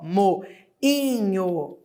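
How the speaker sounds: moinho drawn out very slowly, mo-i-nho, each syllable apart; teacher pronouncing moinho slowly to show the hiatus; sounded out aloud. Shown as a demonstration. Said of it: "pronunciar alto".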